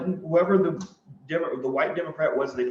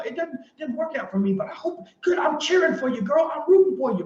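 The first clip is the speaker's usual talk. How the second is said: mock cheering; 9 semitones higher than usual. Sarcastic cheering for her new job.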